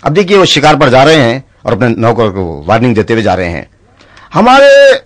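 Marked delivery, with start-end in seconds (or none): 4.3-4.9 high, loud lordly call; commanding nawab. Acted. Nawab calling for his servant, loud and lordly. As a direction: summon the servant like a grand lord.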